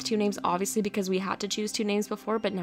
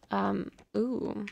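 ordinary delivery

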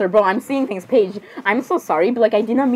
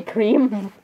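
ordinary talk

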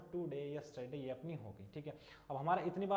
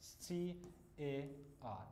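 spelling out slowly; 4 dB quieter, much slower than usual. Shown as a demonstration. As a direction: spell out letter by letter, very slow. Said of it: "मैंने एक शब्द लिखा आपके सामने". (अक्षर-अक्षर बहुत धीरे बोलना)

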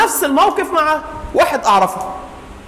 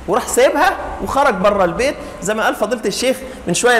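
ordinary talk

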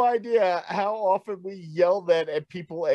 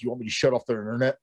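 fast quoted line; 8 semitones lower, much faster than usual. Retold or imitated. Quoted tech friend.

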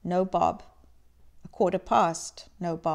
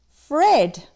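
calling out; 5 semitones higher, 5 dB louder than usual. A narrator's reading. Scrooge calls into room.

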